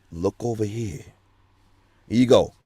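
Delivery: normal talking voice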